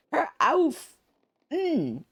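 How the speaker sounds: put-on very high, whiny child pout; sulky little kid stomping; pouty kid voice. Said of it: "like a kid saying it like the really pouty voice".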